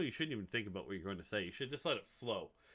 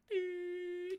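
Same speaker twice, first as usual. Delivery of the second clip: high squeaky blank-mind sound; blank-out noise. Retold or imitated. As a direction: squeak out a dumb empty noise. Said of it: "you're just like".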